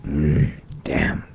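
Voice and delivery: pained groan drawn into a curse; acted pain. Vampire testing the sunlight gets burned, pained cursing. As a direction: groan and curse through the sting. Character steps out into burning sunlight.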